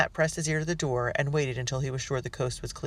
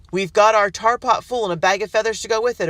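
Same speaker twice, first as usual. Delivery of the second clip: loud, gloating threat; menacing shout; 7 semitones higher, 9 dB louder than usual. A narrator's reading. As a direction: threaten through the door. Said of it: "a voice shouted".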